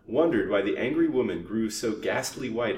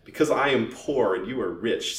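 mock-tearful complaint, a bit louder; affected weeping; 3 semitones higher, 6 dB louder than usual. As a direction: feign hurt with a touch of weeping. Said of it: "affecting to weep".

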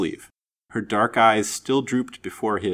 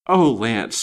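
louder, emotional exclamation; impassioned cry; 2 semitones higher, 7 dB louder than usual. A narrator's reading. Lady appealing to the man she loves, impassioned cry. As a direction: cry out his name with feeling. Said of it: "she cried".

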